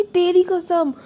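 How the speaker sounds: तेरी कसम shouted high and loud; loud oath. Retold or imitated. Sister swears on her sister's head, shouting तेरी कसम. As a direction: swear तेरी कसम loudly, with तेरी hit hardest. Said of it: "सिर पे रख के कसम खाती है".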